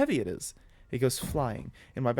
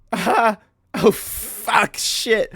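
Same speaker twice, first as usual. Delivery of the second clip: loud, high outburst of swearing; alarmed cursing; 8 semitones higher, 12 dB louder, much slower than usual. Acted. Jamie sees the bag's fate, alarmed cursing. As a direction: yelp and curse in alarm. Bag disaster, acted outburst.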